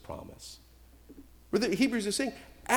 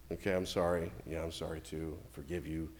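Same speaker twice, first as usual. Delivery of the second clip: much lower and softer, grudging mumbled apology; sulky kids' apology; 10 semitones lower, 10 dB quieter than usual. Retold or imitated. Bickering children forced to apologize to each other, grudging.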